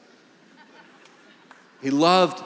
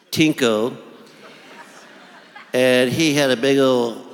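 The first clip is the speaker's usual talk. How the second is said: slow, drawn-out dentist drawl, louder when flustered; 4 dB louder, slower than usual. Retold or imitated. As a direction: tell the fishing story like a plodding old dentist. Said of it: "had a voice like this".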